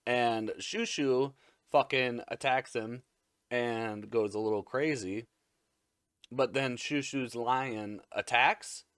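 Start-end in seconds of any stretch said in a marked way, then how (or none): none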